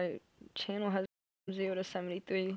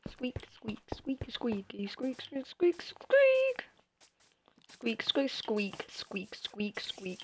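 mock squeaking; 4 semitones higher, much slower than usual. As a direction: squeak like a tiny monkey. Mimicking the baby monkeys.

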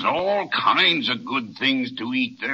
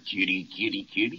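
softer, gentle kitty call; coaxing softly; 8 semitones lower, 7 dB quieter, faster than usual. Acted. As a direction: coax the kitten over softly. Giant coaxing the kitten.

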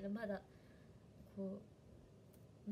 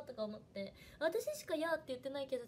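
ordinary talk, nothing marked

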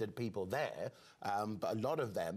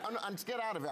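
normal talking voice

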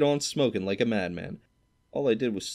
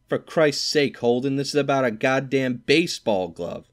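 higher and louder, exasperated; annoyed outburst; 6 semitones higher, 6 dB louder than usual. A narrator's reading. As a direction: blow up at Holden over the glove. Stradlater annoyed at the composition.

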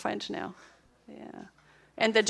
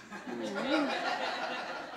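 a real burst of laughter; hearty laugh; 8 dB quieter than usual. Real, not acted. Teacher laughing at her one-day Duolingo story, real laugh.

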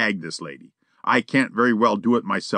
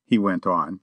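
ordinary delivery, nothing marked

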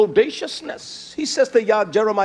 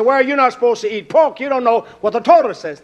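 lightly mimicked scolding, louder; mock objector; 7 semitones higher, 7 dB louder than usual. Critics telling him he's not supposed to eat pork, mock scolding. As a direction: mimic a critic laying down the rule. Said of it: "there are those that will say".

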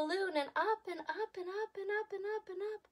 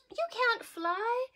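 mocking bird; 9 semitones higher, 3 dB louder, slower than usual. Bird taunts again.

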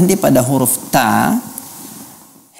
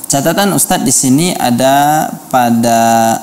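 ordinary talk

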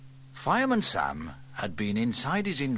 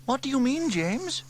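put-on high, bright-eyed girl wondering aloud; young girl Sarah, curious; 6 dB louder than usual. A narrator's reading. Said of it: "asked Sarah".

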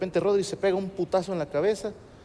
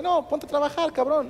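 mock exasperated scolding; 9 semitones higher than usual. Scolds clerk asking for photo.

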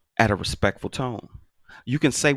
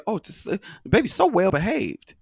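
mock admiring strangers; 10 semitones higher than usual. Strangers praising daughter.

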